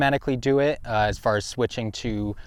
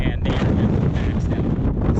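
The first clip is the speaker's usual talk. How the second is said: blown-out wind noise; slower than usual. Real, not acted. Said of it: "probably can't even really hear or understand".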